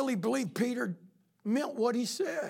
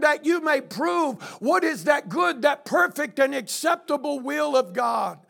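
louder, driving pulpit preaching; preaching at full force; 3 semitones higher, 6 dB louder than usual. Acted. Pastor exhorting his church, preaching loud and fast. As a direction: drive it home to the congregation.

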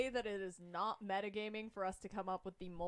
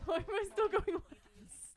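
laughing whine; 8 semitones higher than usual. Real, not acted. Bad initiative roll, laughing.

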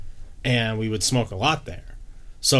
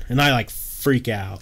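ordinary delivery

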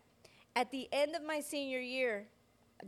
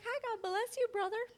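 much higher, overly sweet hello; sugary church greeting; 10 semitones higher than usual. Retold or imitated. To the man she once flirted with, a much higher, sweet greeting. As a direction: greet him sweet as pie, all innocence.

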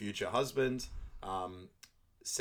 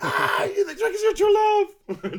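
very high, loud, drawn-out shriek of disbelief; hammed-up squealing outburst, slow and loud; hysterical mock shout; 14 dB louder than usual. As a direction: burst out yelling in mock hysterics. Repeat take, both burst out.

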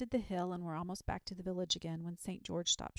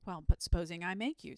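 a touch of annoyance, louder; mildly piqued challenge; nettled; 7 dB louder than usual. A narrator's reading. Saint George challenging the unwilling dragon, rather nettled. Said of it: "rather nettled".

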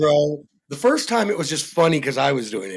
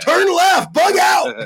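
shouting; 12 semitones higher, 6 dB louder, slower than usual. Acted. Shouted command.

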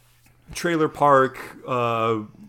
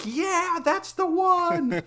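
put-on high, slow kid awe; a little boy picking his favorite truck; excited kid; much slower than usual. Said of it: "was like".

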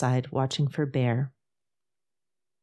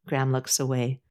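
plain reading voice

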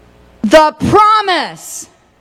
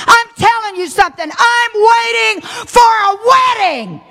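preaching at full force; 6 semitones higher than usual. Acted. Preaching builds to a shout.

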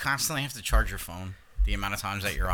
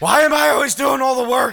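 much higher, loud shrieked complaint; mock exasperated shout; 11 dB louder than usual. Teasing a friend about the bedroom, mock shriek. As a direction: yell it like a fed-up lover.

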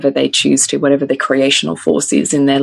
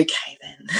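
soft whispered okay then; 15 dB quieter than usual. Retold or imitated. Mother imitating daughter giving mic back, whispered. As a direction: breathe it like a shy kid. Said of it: "she was like".

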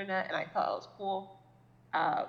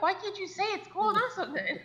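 much higher, louder, exasperated; exasperated inner voice; 13 semitones higher, 4 dB louder than usual. Retold or imitated. Silently annoyed at his niece's slang, high exasperated. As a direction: squeak out the frustration inside your head. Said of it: "in my head, I'm like".

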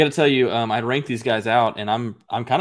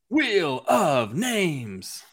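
stretched, very slow game-show call; drawn-out announcer echo; 5 semitones higher, much slower than usual. Acted. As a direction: drag each word like a game-show host.

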